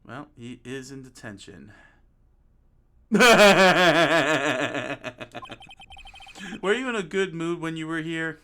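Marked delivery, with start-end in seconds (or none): 3.2-6.7 long, loud cackle trailing off; mocking laugh. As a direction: burst into a long laugh at his expense. Visitor laughs at jailed friend.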